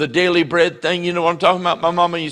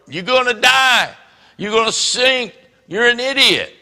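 higher, scoffing jeers at Peter; mocking warning; 6 semitones higher than usual. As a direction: scoff at him like a doubter. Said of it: "they were going".

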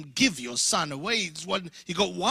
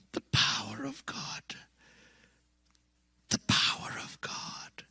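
awed whisper; 8 semitones higher, 5 dB quieter than usual. Real, not acted. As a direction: breathe it in wonder. Awed whisper closing the testimony.

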